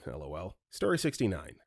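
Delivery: plain reading voice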